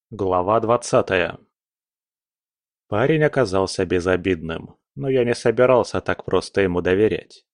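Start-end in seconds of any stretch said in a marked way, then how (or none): none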